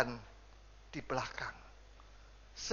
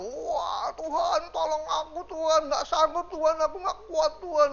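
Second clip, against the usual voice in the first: high, sobbing cry to God for help; 8 semitones higher than usual. Retold or imitated. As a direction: wail it like a desperate prayer with tears. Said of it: "nangis yang serius sekali itu".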